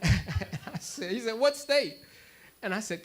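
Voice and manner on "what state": high, loud imitated demand; insistent questioning. Aswan vendor asking where he is from, comically insistent. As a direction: press for the answer, playing it up. Vendor presses him for a place.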